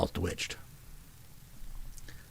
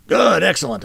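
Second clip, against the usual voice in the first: louder, higher delighted praise; excited praise; 8 semitones higher, 8 dB louder than usual. A narrator's reading. Brother praising sister's first try, louder and delighted. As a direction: cheer her on warmly.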